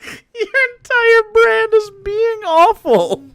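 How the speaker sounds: high, slow mocking jab. Real, not acted. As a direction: sneer it out in a high sing-song. Mocking reply to the streamer.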